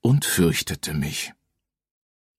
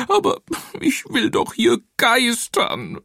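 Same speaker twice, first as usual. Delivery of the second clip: weeping ghost. Acted. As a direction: wail it like a sobbing little ghost. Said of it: "heulte das Gespenst".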